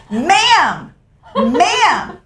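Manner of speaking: high, insistent calling out "Ma'am!"; bossy stranger. Retold or imitated. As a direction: nag her like a rule-enforcing hiker. Stranger calling after hiker.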